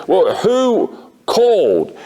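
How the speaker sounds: high, drawn-out mimed call. Retold or imitated. Teacher asking the office who phoned, mimed calling out. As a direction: call the question up to a window, slowly. Retold exchange shouted across playground.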